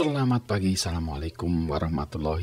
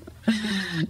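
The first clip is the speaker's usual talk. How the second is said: a short high giggle; high laugh; 11 semitones higher than usual. Real, not acted. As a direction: giggle at yourself. Laughing at own story.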